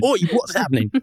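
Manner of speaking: high mock Cockney "Oi, what's happening?"; Cockney panic. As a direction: yelp "Oi" in a hammed-up Cockney. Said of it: "landed in a Cockney planet".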